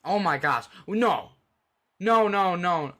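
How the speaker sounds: loud, high, stunned "no, no, no"; shocked disbelief. Real, not acted. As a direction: react as the game slips away. Game lost on last play.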